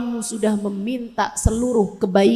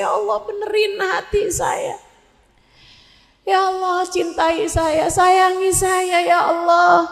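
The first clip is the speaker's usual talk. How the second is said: humble prayer; 4 semitones higher than usual. Retold or imitated. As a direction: beg God for forgiveness in a hushed, tender plea. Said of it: "kemudian berkata".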